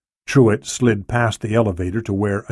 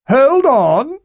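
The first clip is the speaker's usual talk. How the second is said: high, raised call through a door; 5 dB louder than usual. A narrator's reading. Club owner answering a knock, calling out. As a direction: call out to hold off a visitor.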